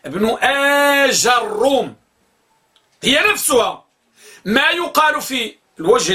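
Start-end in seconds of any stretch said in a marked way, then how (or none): 0.1-1.9 ابن آج الروم said slowly with the jeem given a; teacher models the second form; slow demo pronunciation. Shown as a demonstration. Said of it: "اللغة الثانية".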